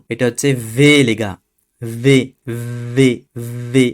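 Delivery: "ভে" said with lip on teeth, not "বে"; French V. Shown as a demonstration. Teacher contrasting "ভে" with "বে" for students. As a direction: buzz "ভে" through the teeth. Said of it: "এটা বে না কিন্তু".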